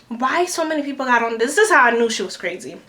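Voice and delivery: normal talking voice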